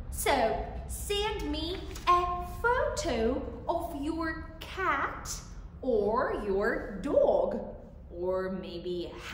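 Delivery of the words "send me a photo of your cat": ordinary talk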